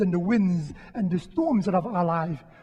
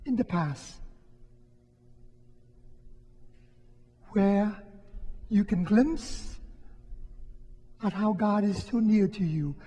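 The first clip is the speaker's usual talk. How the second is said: guided meditation; 3 dB quieter than usual. Acted. Priest guiding the congregation through a quiet prayer exercise, soft and unhurried. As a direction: lead the congregation gently into memory. Leads a reflection exercise, eyes closed.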